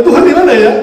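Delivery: mock demand. Mock asking for God.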